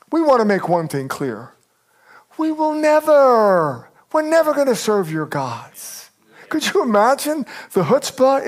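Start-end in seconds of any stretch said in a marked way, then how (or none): 2.4-6.1 NEVER drawn out high and slow; defiant never. Acted. Voicing defiance to the king.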